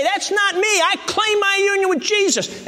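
high, ringing declaration of faith; emphatic declaration. Acted. Models a bold declaration of faith.

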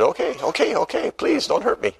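a hammed-up plea for mercy from King John; mock frightened plea. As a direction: beg like a cornered king. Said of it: "and he said".

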